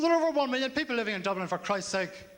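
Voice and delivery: huffing at a silly question; exasperated retort. Retold or imitated. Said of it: "I said".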